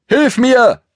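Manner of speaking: high, loud call for help; pony calling out to his friend in the chase. Acted. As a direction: shout across the field to your friend. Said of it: "Rief Schoko ihm zu".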